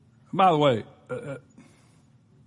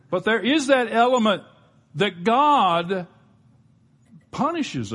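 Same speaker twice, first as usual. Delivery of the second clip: higher, louder preacher's swell; preaching burst; 9 semitones higher, 6 dB louder, slower than usual. Real, not acted. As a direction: drive the point home from the pulpit.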